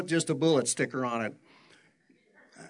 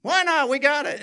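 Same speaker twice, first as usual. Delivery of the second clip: eager excited son; 12 semitones higher, 9 dB louder than usual. Acted. Younger son urging to go experience life, excited. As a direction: burst out like a son itching to go live.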